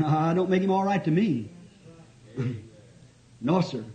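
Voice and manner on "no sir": ordinary delivery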